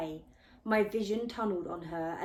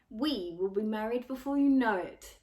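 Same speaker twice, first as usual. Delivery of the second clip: louder, possessive taunt; lizard-faced boy taunting the narrator, louder; taunting bully; 3 semitones higher, 7 dB louder than usual. A narrator's reading. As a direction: claim her to rile the boy. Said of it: "Lizard Face continued to taunt me".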